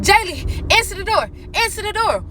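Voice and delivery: yelling for her daughter to open up; retold scream. Mother on the floor yelling at daughter to get the door. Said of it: "So I'm screaming".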